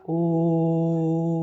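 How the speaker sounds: ओम with the ओ held long; chant-like ओम, the vowel drawn out. Shown as a demonstration. Teacher repeating ओम for the class, vowel held long. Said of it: "ओ को लंबा खींचा जाता है".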